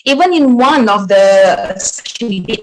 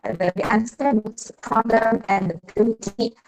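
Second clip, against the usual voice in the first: ordinary talk, nothing marked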